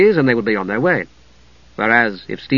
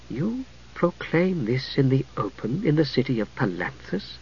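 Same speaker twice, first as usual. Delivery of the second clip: in wonder; 10 dB quieter than usual. A narrator's reading. Priestess to the dark knight, hushed in wonder. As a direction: ask in quiet amazement, not threat. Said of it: "not threatening, but in wonder".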